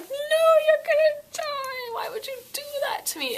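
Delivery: high, loud mock wail through fake tears; mock sobbing. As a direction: ham up a crying protest at the book. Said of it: "I was like".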